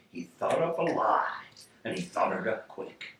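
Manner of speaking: ordinary delivery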